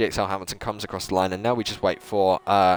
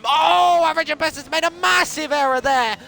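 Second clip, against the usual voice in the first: much higher, louder cry of disbelief; excited shout; 4 dB louder than usual. Real, not acted. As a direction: shout it like the race just flipped. Commentator erupts at a crash.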